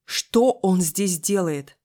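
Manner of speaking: loud, slow, angry question; barked demand. A narrator's reading. Friend jabs a finger at the cop, barking. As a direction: bark it out, stretching every word. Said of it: "и рявкнула".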